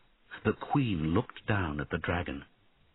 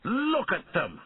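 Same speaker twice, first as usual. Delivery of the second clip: angry shout; 12 semitones higher than usual. A narrator's reading. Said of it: "shouted Einan angrily".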